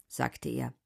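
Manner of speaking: ordinary delivery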